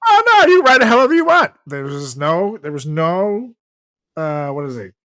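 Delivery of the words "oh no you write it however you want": excited. Real, not acted. Sudden eager correction.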